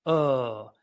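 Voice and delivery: each UH held long, sliding down; slow vocal-exercise "uh"; drawn-out demonstration. Shown as a demonstration. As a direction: cup without its C, stretched to a long UH. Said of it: "take away the C and go".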